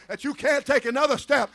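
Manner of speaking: ordinary talk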